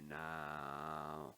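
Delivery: slow, low, distorted alien voice; alien-sounding voice. Real, not acted. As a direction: sound like an alien over a bad mic. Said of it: "I sound like a alien".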